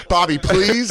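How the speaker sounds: high, pleading director; Scorsese. Retold or imitated. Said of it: "Scorsese's like".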